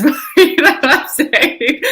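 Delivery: laughing through the words, slow and high. Real, not acted. Laughing at her own hoochie joke with friends. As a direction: let the laugh take over the line.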